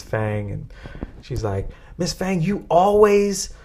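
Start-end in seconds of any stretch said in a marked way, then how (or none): 2.0-3.5 retold accusation. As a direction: lightly act out an aggrieved classmate accusing the teacher. Voicing classmate accusing the teacher.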